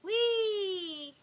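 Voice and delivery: high, loud squeals of joy; gleeful whee. Real, not acted. Sliding down, gleeful cries.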